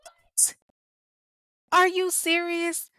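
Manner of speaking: very high, stunned cry of disbelief; real shock, voice shooting up. Real, not acted. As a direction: react like you can't believe your ears. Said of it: "I'm sitting back shocked".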